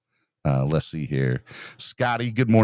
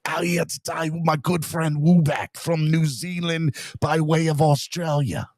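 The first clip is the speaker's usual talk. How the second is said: slow, higher, cheesy put-on Australian accent; worst Aussie accent; 7 semitones higher than usual. Host greeting a viewer from New Zealand, bad Aussie accent. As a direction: ham up a bad Aussie voice, dragging it out. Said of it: "Worst Aussie accent".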